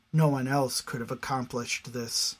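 ordinary delivery

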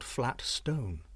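plain reading voice

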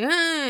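short high fake wail; mock child's cry. Kids crying back at their dad, high mock wail. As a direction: imitate a kid crying on cue. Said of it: "so they'll cry".

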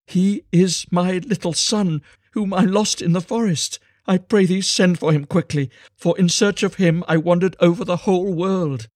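high, loud, impassioned outpouring; joyful plea at a high pitch; overjoyed cry. A narrator's reading. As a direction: cry out with overwhelming emotion. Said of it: "she wept with joy".

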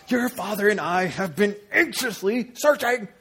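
very high, strained, building outburst; in-your-face scolding. Acted. Frantic mother to missing son, hammed-up angry outburst. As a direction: build to a loud, high finish. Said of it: "She's getting into Jesus' face".

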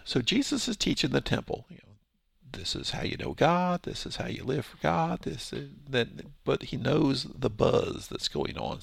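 ordinary delivery

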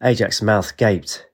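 ordinary delivery

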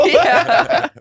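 high laughing yeah. Real, not acted. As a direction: squeal it through laughter. Laughing along.

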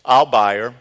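loud, commanding bid across the crowd; firm call out. Acted. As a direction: call out the bid to claim her. Hosea bids at slave auction.

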